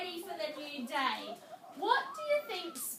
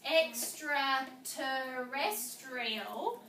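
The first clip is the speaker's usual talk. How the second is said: EXTRATERRESTRIAL slowly, one chunk at a time; very slow, measured EXTRATERRESTRIAL in parts; in syllable parts; 2 semitones lower, much slower than usual. Shown as a demonstration. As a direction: demonstrate EXTRATERRESTRIAL broken into syllables. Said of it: "if you see it in parts".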